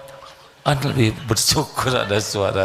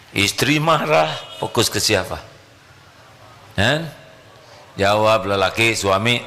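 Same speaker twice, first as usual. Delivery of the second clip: ordinary delivery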